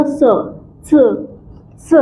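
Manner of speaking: स said as "ts", half t into s; German Z sound. Shown as a demonstration. Teacher drilling Z for students, स as "tsuh". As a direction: clip a half t onto स, "tsuh". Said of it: "आधा त".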